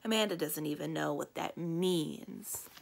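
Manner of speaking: ordinary delivery, nothing marked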